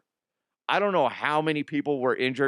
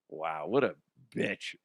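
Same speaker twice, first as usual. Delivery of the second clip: much lower and softer; low deadpan aside; 10 semitones lower, 6 dB quieter than usual. Real, not acted. Host judging the scooter lady, low quiet aside. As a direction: drop it under your breath.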